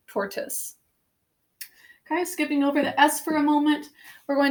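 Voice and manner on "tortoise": "tortoise" whispered as tor-tiss, O silent. Shown as a demonstration. Teacher to class, shows silent O in a whisper. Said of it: "almost like just this O is silent".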